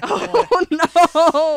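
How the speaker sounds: very loud, high, drawn-out cry; big hammed-up howl of dread; comic horrified wail. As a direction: wail it in mock doom. Child dreading punishment, comic climax.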